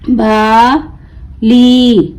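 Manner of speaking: बाली very slow, long बा, pause, long ली; broken into syllables. Shown as a demonstration. Teacher breaking the word for learners, बा... ली stretched apart. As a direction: draw out Bali as two separate syllables. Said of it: "तोड़ तोड़ कर पढ़ूंगी".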